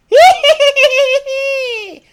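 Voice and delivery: shrieking high witch cackle; loud cackle like an old hag; evil witch laugh. Pretend. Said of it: "let me do my evil witch laugh".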